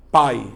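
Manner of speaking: "pai" with a loud strong A and weak i; strong A sound. Shown as a demonstration. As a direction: hit the A in "pai" hard, let the i fade. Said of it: "a letra A, ela tem um som mais forte".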